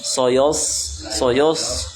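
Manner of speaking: SOYOS with all syllables thick. Shown as a demonstration. Teacher repeating the mistake to avoid, thick SOYOS. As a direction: darken every vowel of SOYOS to O.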